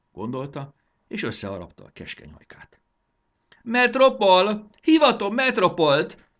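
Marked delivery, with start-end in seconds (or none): 3.6-6.3 high, slow, drawn-out summons; imperious high-pitched yell; shouting. Acted. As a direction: bellow for a servant across the palace. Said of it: "kiabált Mondán".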